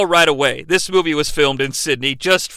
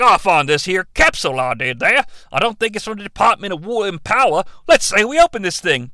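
broad mock Aussie workman; Australian accent. Construction worker reporting a found capsule, exaggerated Australian accent. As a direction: parody an Australian labourer's vowels. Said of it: "the Australian construction workers".

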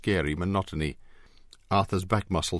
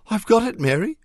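much higher and louder, breathless disbelief; can't believe he actually found it; incredulous gasp; 8 dB louder than usual. A narrator's reading. Arthur telling Mary he found the ring, gasping incredulously. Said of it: "Arthur gasped incredulously".

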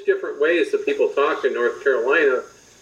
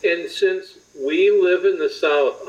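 ordinary talk, nothing marked

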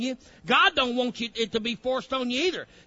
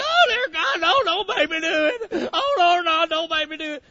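high, loud hammed-up crying begging; mock sobbing plea, much higher and louder; mock wailing plea; 8 semitones higher, 7 dB louder than usual. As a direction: wail like someone dragged kicking and screaming. Mock reluctant convert begging God.

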